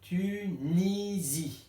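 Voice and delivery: "Tunisie" very slowly, Tu, ni, zie; syllable by syllable. Shown as a demonstration. Teacher finding the missing syllable, syllable by syllable. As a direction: stretch each of the three syllables of "Tunisie". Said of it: "Qu'est-ce qui manque?".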